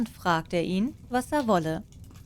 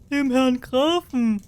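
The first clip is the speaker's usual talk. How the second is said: loud, higher, slow chorus shout; peasants calling out; 5 semitones higher, 6 dB louder than usual. Acted. Peasants call out to the king, loud and slow. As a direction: call it out together as the crowd.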